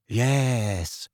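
dreamy. A narrator's reading. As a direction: drift off as you say it. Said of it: "said Luna dreamily".

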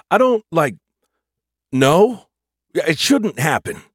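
high, loud, halting dude voice; baffled dude AI. Acted. Bilby unable to explain the failure, baffled dude.

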